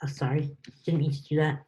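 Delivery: ordinary talk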